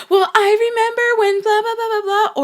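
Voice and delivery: mock the self-absorbed friend; mocking whiny voice. Mocking a self-centred person.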